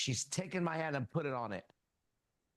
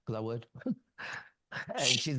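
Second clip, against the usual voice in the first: normal talking voice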